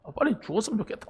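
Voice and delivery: mock teenage resentment; irritated grumble. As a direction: toss it off quickly under your breath. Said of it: "막 짜증나는 거야".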